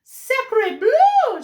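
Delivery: very high, loud French exclamation; a duck gasps in French; French duck cry. Acted. Duck sees the nest and cries out in French. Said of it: "she cried".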